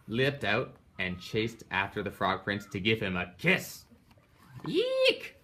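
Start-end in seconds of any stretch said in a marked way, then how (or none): 4.7-5.3 very high squealing eek; frightened shriek. Acted. Froggy shrieks as Frogalina chases him for a kiss. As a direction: squeal in panic. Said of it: "cried Froggy".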